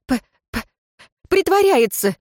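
high, halting stutter; unsure stammer. A narrator's reading. Archer answers the nobleman, unsure stammer. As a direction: stumble on the first sound uncertainly. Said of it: "неуверенно отозвался Филис".

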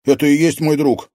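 high, loud reassurance; exclaiming. A narrator's reading. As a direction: urge him loudly to calm down. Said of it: "воскликнул Артём".

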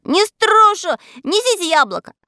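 high, loud squeaky cry; defiant shout. A narrator's reading. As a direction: yell back stubbornly. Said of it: "кричал Мурзилка". (упрямо кричит тонким голоском)

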